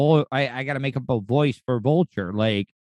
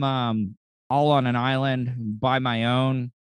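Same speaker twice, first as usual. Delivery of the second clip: normal talking voice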